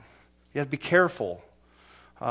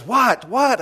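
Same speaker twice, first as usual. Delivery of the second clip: loud, higher, snapped "What? What?"; irritated yell; 9 semitones higher, 9 dB louder than usual. Retold or imitated. Barricaded man shouting at the negotiator, irritated. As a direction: bark it like an annoyed man answering again. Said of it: "And he goes".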